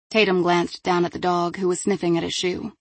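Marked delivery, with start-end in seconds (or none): none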